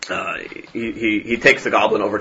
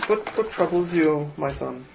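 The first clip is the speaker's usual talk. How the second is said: gentle priest; 6 semitones higher, 7 dB quieter than usual. Acted. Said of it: "the priest says".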